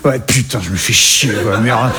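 very low, moaning caricature of a Parisian; imitated Parisian accent. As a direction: grumble in a deep, put-on Parisian accent. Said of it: "C'est comme ça qui sonne le parisien". (accent parisien imité, très grave et blasé)

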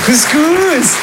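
high sing-song call of couscous; high mock mother. Mother serving her son again, high sing-song call. Mother's line repeated higher.

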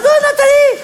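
Nathalie yelled sky-high in despair; exasperated high call. Acted. Husband exasperated at his wife, high yelled name.

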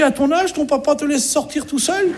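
high-pitched mimicked lady; put-on high voice of a concerned lady; lady addressing child. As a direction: question a small child in a high voice. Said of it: "il y a une dame".

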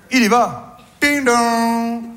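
mock doorbell. Doorbell imitation.

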